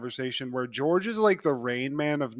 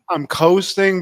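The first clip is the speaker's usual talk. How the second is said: louder, higher echo of the word; emphatic echo; 4 semitones higher, 10 dB louder, slower than usual. Real, not acted. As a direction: repeat it with a lift. Echoes word loud and high.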